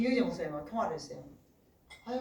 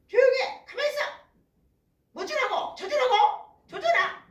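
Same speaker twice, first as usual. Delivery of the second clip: loud, high, barked mock commands; scolding mother; 8 dB louder than usual. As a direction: bark at the kids like a fed-up mother. Imitates mothers scolding their children.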